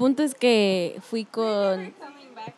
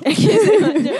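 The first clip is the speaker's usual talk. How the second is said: high, loud laughing echo; 8 semitones higher, 5 dB louder, slower than usual. Real, not acted. Friend echoes the story prompt, laughing. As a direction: repeat it through real laughter, pitched up.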